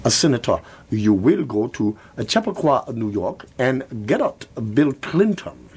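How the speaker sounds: hammed-up fake Chinese accent; a mock Chinese accent. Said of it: "in a fake Chinese accent".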